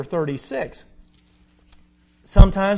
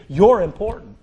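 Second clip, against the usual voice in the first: emphatic declaration; 7 dB louder than usual. Real, not acted. Preacher affirming the congregation.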